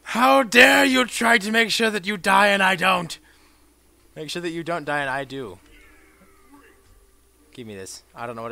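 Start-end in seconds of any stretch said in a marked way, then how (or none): 0.0-3.2 loud indignant shouting; mock outrage. Real, not acted. Yelling at the enemy who tried to heal. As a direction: play it offended and loud.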